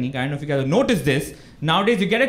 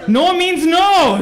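slow, loud, high refusal; shutting down the pushy caller with a yell; firm shout; 11 semitones higher, 6 dB louder, much slower than usual. Retold or imitated. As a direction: spell it out slowly and loudly. Refusing the telemarketer.

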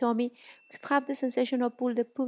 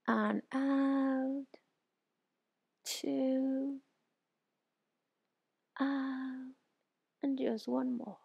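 guided breathing; 2 semitones higher, much slower than usual. Shown as a demonstration. Guided stretch breathing cues.

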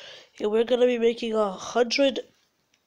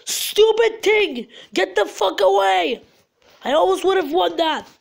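high, loud raging at the game; heated, raised-pitch outburst; angry yell; 7 semitones higher, 4 dB louder than usual. Real, not acted. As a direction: yell in furious frustration. Rages at the game.

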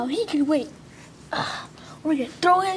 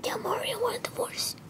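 breathless dying whisper; whispered last words; 7 semitones higher, 13 dB quieter than usual. Acted. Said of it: "Peach wants to say her last words".

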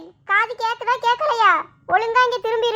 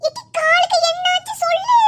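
worried mother; 5 semitones higher than usual. Acted. Mother alarmed at daughter's leg, worried. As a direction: rush in like a startled mother.

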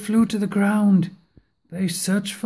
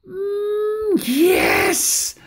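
high, cheering yes; delighted yes; 12 semitones higher than usual. A narrator's reading. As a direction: shout yes with glee. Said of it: "said the witch".

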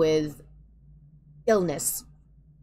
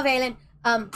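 ordinary talk

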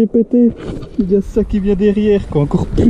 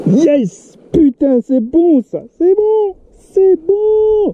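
high, thrilled exclaiming; excited; 8 semitones higher, slower than usual. Real, not acted. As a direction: cheer at the catch. Rejoicing at the catch.

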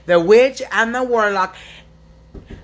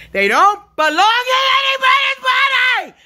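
real shout; 11 semitones higher than usual. Real, not acted. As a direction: shout it out as a protest. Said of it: "This yelling and screaming".

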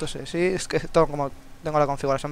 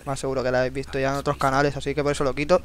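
ordinary talk, nothing marked

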